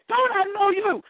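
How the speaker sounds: high, insistent challenge; very stern. Retold or imitated. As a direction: blurt it high, demanding recognition. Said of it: "I said it very stern".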